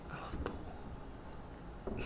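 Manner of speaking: slow breathy whisper of I love pool. Shown as a demonstration. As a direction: whisper love drawn out slowly. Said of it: "whispered repeat of own line".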